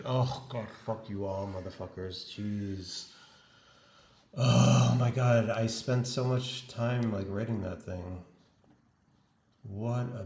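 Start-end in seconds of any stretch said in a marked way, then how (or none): 4.4-5.5 loud exclamation. Real, not acted. Realizing the work is lost.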